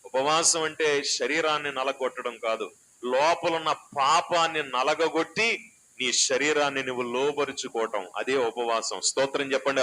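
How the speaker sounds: fiery sermon voice; full-force preaching. Acted. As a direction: drive it home from the pulpit.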